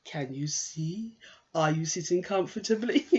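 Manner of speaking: put-on slow, sing-song voice for small children; nursery school teacher. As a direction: play at being a nursery teacher. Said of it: "I'm at nursery school".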